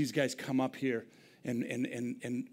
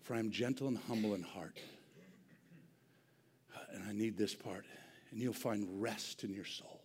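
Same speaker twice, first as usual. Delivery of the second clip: soft tender scripture; quiet gentle scripture; 7 semitones lower, 11 dB quieter than usual. Acted. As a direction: read it softly and tenderly. Gentle scripture quote softly.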